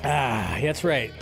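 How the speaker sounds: ordinary delivery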